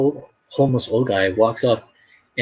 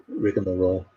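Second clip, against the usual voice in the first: normal talking voice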